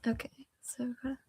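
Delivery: quick whispered aside. Real, not acted. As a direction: whisper it fast.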